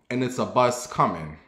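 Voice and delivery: normal talking voice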